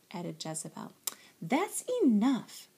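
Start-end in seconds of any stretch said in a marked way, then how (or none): 1.4-2.7 slow, higher motherly scold. A narrator's reading. Mama stops the girls' teasing, scolding. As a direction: cut the teasing off, drawn out. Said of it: "scolded Mama".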